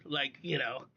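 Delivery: ordinary talk, nothing marked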